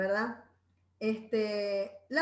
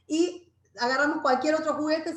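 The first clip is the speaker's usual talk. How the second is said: ordinary delivery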